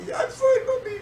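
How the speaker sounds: very high, squeaky child imitation; mock tearful little-boy squeak; little brother. Scared small boy covering up to mother, high squeaky voice. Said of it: "he said".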